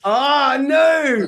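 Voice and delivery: sudden high loud cry of dismay; mock dismay. Real, not acted. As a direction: yelp it out like the bad news just hit you. Host reacts to learning nothing.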